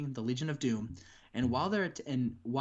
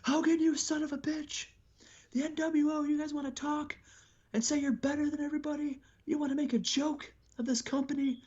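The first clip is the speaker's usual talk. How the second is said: wrestler promo; 14 semitones higher than usual. Retold or imitated. Raven calling out Hogan, a high angry promo. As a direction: cut a furious promo at Hogan. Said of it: "he says".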